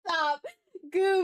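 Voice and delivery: usual speaking voice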